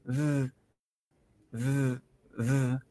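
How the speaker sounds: "Z" as a soft buzzed th, tongue between teeth; voiced TH sound. Shown as a demonstration. Teacher shows learners the tongue position, isolated TH buzz. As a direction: hold a quiet voiced th for each "z". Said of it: "pronunciamos la T H poniendo la lengua entre los dientes".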